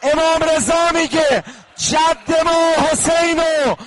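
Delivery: high, loud and slow wailing chant; full-voice lament. Real, not acted. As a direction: wail it out to the whole shrine, slowly. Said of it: "حالا اگر داد میزنی".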